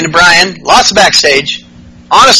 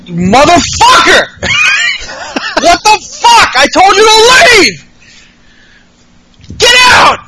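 mock screaming. Shane screaming at them to get out, hammed-up shrieking. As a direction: shriek it out like an unhinged boss. Shane yelling at intruders retold.

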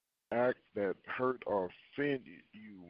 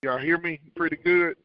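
much louder, higher sound check; speaking up; 6 semitones higher, 13 dB louder than usual. Real, not acted. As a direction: raise your voice to check they can hear. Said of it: "speak up a little louder".

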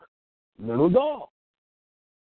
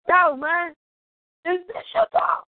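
calling out; 10 semitones higher than usual. Retold or imitated. Calling out to young man.

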